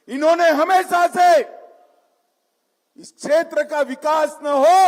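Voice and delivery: rousing crowd-pumping oratory; booming political rally speech. Real, not acted. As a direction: call out to the crowd from the stage.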